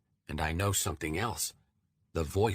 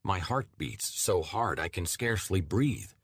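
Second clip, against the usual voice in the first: usual speaking voice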